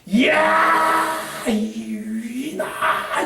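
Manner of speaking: long, high cry flung out; yell at the sea. Shown as a demonstration. Preacher shouting at the sea to show release. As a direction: let it all out over the waves. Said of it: "그 바닷가를 향해서".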